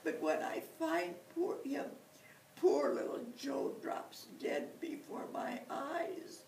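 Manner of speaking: weeping bear confesses his grumpiness; sobbing bear. Acted. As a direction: cry it out, broken and breathy. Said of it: "the bear began to cry big tears".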